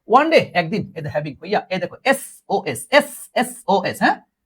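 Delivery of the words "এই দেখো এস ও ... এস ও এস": slow spelling. Shown as a demonstration. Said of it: "save of soul".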